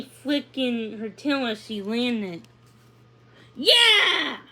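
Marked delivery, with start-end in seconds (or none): 3.6-4.4 high, loud cheer of triumph; a joyful victory shout. A narrator's reading. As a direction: yell it out as she wins the race. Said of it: "Pippa shouted".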